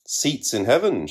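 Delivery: high, toneless street-seller cry. A narrator's reading. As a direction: call it out like a pale street hawker.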